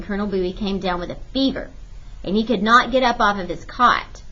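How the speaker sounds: ordinary delivery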